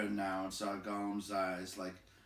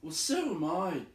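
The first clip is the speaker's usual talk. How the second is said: crying out; 8 semitones higher, 6 dB louder than usual. Acted. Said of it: "cried Bilbo".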